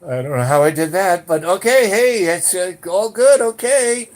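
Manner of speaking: awkward squeaky okay to a stranger's thanks; baffled reply. Retold or imitated. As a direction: go up high and loud, nervously playing along. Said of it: "And I'm going".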